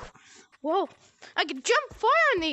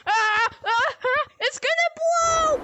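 terrified horror victim; 9 semitones higher, 4 dB louder than usual. Real, not acted. Explosion coming, panicked cries.

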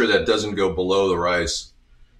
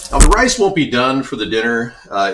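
normal talking voice

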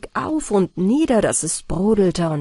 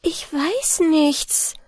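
helpless; 8 semitones higher, much slower than usual. A narrator's reading. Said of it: "antwortete Anna Barbara".

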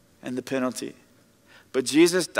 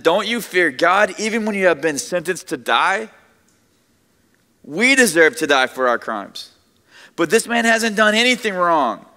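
loud, higher rebuke rising to a cry; protesting; 5 semitones higher, 5 dB louder than usual. Retold or imitated. Second criminal rebuking the scoffer, raised urgent protest. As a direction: call out the other thief, pleading his case. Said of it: "the other criminal protested".

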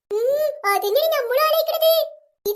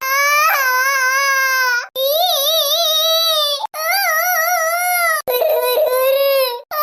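loud, long-drawn mock sobs; fake wailing; 5 dB louder, much slower than usual. Two children crying together for show, hammed-up wailing. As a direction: bawl theatrically on held vowels. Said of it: "നമുക്ക് രണ്ടാക്കും ഒന്നിച്ച് കരയാടി".

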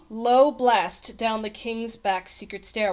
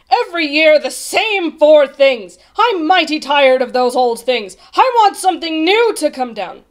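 loud, raised royal rant; bellowing; 6 semitones higher, 12 dB louder than usual. A narrator's reading. King tired of the same four things, bellowing. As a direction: bellow your complaint, fed up. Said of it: "bellowed the king".

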